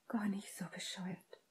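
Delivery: hushed, breath-only reply; soft whisper under the breath. A narrator's reading. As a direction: whisper it very softly. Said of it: "flüsterte Hermine".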